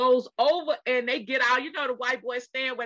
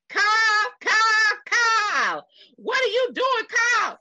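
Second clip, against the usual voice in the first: Texas wail; 10 semitones higher, 6 dB louder than usual. Retold or imitated. Re-doing the women's screams in a Texas accent, loud and high. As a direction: cry out Kyle the Texas way. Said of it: "Let me say it like we say it in Texas".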